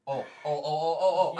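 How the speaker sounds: rising excited oh oh oh; excited yelps. Real, not acted. Excited outburst at the table.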